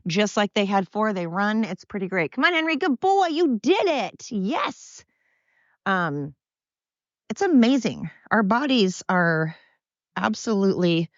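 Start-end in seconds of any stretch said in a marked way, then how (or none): 2.3-5.1 high, drawn-out pet praise; praising a dog. Real, not acted. Owner praising blind Henry for making it, high delighted praise. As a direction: cheer the dog on in a high, slowing sing-song.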